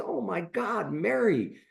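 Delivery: high, rising alarmed cry; shocked alarm. Retold or imitated. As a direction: recoil in horror at what's at the door. Doctor finds mangled patient.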